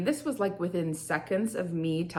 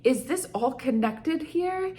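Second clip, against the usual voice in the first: higher, startled wondering; sudden realization; 6 semitones higher than usual. Retold or imitated. To herself, linking headaches to emotions, surprised. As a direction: catch yourself mid-thought, amazed. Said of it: "I'm like".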